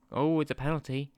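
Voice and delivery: loud, sudden burst of surprise; excited surprise. Real, not acted. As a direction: react to a sudden penalty. Surprised at penalty in game.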